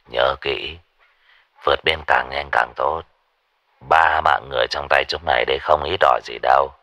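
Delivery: low, quiet menacing boss voice; gang boss on phone. Acted. Boss Phong instructing thugs by phone, low and quiet. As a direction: give orders low and cold.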